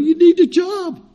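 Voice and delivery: high, urgent plea to a man who quit; pleading insistence. Retold or imitated. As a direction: raise the pitch and press the point hard. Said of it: "I'm trying to tell him".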